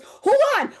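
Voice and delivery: high, loud excited call; excited hold on. Retold or imitated. A friend interrupting the phone call, loud and excited. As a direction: stop the call, thrilled.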